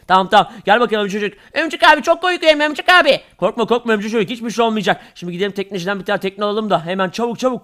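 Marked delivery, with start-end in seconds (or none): 1.5-3.2 put-on high, frightened kid voice; small boy admitting he is scared; scared little kid. Kid sidekick voice in play.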